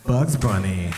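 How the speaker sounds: ordinary talk, nothing marked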